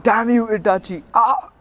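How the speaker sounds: high, loud cursing yell ending in a cry; hurt fighter shouting at his traitor partner; pained angry shout. Acted. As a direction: curse him as a bone breaks. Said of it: "Kisame shouted".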